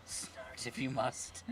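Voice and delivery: ordinary talk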